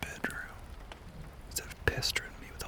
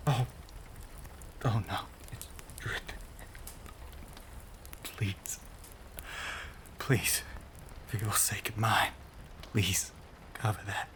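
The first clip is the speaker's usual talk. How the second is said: halting, breathy begging with gasps between phrases; strained, broken-up begging; desperate pleading. Acted. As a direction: plead as if barely holding back a craving. Begs while resisting the blood.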